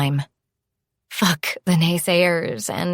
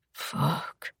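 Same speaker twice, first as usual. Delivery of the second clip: barely voiced whisper; 2 semitones lower, 11 dB quieter than usual. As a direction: breathe the curse out. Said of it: "he whispered".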